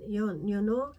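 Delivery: usual speaking voice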